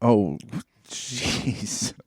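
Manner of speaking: normal talking voice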